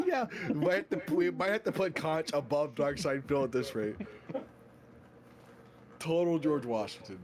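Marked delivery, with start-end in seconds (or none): 0.0-4.0 laughing through the words; giggling while talking. Real, not acted. Laughing while joking about rankings.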